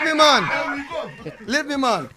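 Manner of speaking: loud, high-pitched plea to be left alone; playful protest. Real, not acted. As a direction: cry out over the laughter. Playful protest amid studio laughter.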